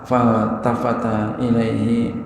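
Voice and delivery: normal talking voice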